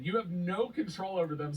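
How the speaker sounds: normal talking voice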